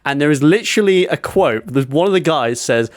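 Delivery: usual speaking voice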